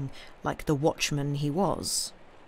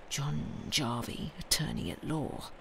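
hushed hiss through clenched teeth; soft sibilant whisper; 3 semitones lower, 10 dB quieter than usual. A narrator's reading. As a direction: whisper the name to yourself, hissing. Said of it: "speaking the words to himself in a soft, sibilant whisper".

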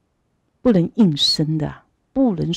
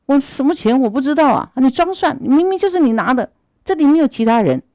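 higher, heated denial; indignant protest; 7 semitones higher, 4 dB louder than usual. Acted. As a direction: protest your innocence hotly. Wrongly accused man protests.

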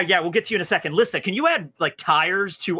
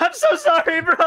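fake sobbing apology; 12 semitones higher than usual.

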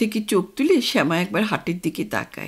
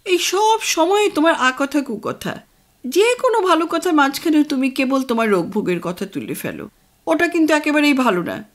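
higher, sharp scolding of her husband; scolding mother; 6 semitones higher, 3 dB louder than usual. Acted. Mother scolds father for talking of his illness, higher scolding voice. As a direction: tell him off for gloomy talk. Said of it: "মা ধমক দিয়ে বলে".

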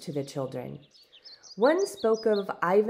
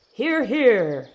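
hearty call; 5 semitones higher, 8 dB louder than usual. A narrator's reading. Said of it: "the mayor sang out".

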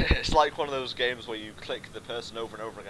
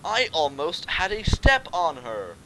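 higher, louder acted self-scolding; put-on character voice, emphatic; pony hero; 5 semitones higher, 6 dB louder than usual. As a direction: exclaim it in a high character voice. Acts player pony's inner thought.

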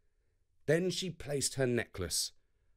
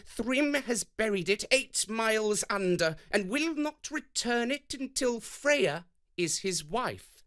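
higher, lightly voiced Loki; Loki reporting; 9 semitones higher than usual. A narrator's reading. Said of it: "And Loki replied".

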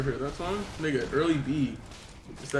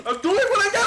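high-pitched laughing yell; laughing shout; 6 dB louder, faster than usual. Real, not acted. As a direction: shriek it while cracking up. Panicked repeat, laughing.

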